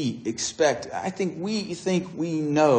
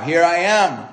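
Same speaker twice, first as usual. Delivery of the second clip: raised, louder triumphant call; mock scream; 5 semitones higher, 6 dB louder than usual. Missionary calls to tribe from far shore, raised shout. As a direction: call out across the water, short of screaming. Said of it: "he screams to the tribe".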